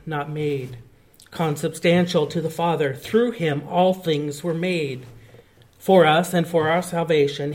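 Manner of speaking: solemn, measured recital of the creed, loud at first; recited like a congregation's creed; creed recitation. Acted. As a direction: proclaim each phrase of the creed.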